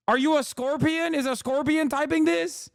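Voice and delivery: incredulous questioning. Real, not acted. Streamer asking whether the chatter is a scorpion, incredulous. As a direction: question them in high-pitched disbelief.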